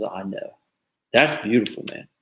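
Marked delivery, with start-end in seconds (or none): 0.0-0.6 much softer, gentle retold reply; softly. Mother answering the officer's apology, soft and gentle. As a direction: say it hushed and tender, much softer. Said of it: "she said softly".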